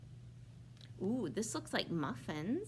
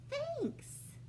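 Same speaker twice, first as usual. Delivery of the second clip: squeaky thanks; 3 dB quieter than usual. Acted. Bear thanks Hare.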